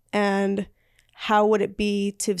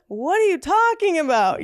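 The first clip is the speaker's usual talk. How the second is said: high, louder incredulous outburst; exasperated; 9 semitones higher, 4 dB louder than usual. Real, not acted. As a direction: cut them off in disbelief. Exasperated reaction to naive belief.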